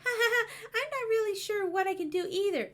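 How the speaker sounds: ordinary talk, nothing marked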